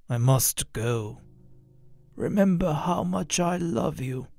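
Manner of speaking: slow, soft fatherly goodbye; letting her go with love; tender farewell. Acted. Dead father softly saying farewell to his daughter.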